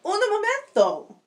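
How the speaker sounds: high, pleading cry. Acted. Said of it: "he cried".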